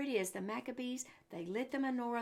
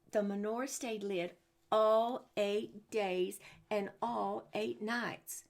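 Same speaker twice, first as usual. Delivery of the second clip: slow and louder, drawing out the miracle; slow with wonder; 4 dB louder, slower than usual. Acted. Teacher revealing the miracle to the children, slow and awed. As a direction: stretch every word in awe.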